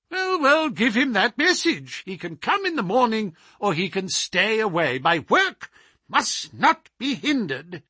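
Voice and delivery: a peevish scholar scolding his butler, pitched high; high petulant voice. Acted. The scholar refusing a visitor to his butler, high and petulant. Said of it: "high, petulant, penetrating voice".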